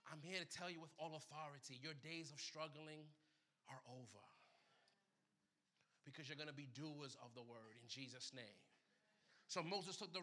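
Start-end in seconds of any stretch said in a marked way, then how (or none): 0.1-8.6 low, soft, steady proclaiming; declaration with authority. Acted. Preacher to congregation, low authoritative declaration. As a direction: speak it over them with quiet authority.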